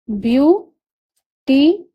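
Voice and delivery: Beauty drawn out as view... टी, each part rising; very slow view, long pause, then टी; syllable by syllable. Shown as a demonstration. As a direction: teach beauty one separated syllable at a time. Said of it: "इसमें तीन syllable हुआ".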